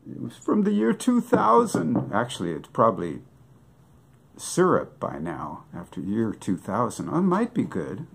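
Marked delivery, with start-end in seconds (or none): 0.1-2.1 much higher lamenting voice; hammed-up grief for the bottle; mock lament. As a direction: mourn the vintage.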